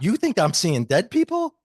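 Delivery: incredulous, voice leaping high; retold disbelief. Retells own disbelief at being called medium.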